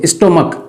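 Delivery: "स्टोमक" ending in k, not ch; a hard k closing "स्टोमक"; correct pronunciation. Shown as a demonstration. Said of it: "स्टोमच नहीं पढ़ेंगे इसको". ("स्टोमक" के अंत में क की आवाज़)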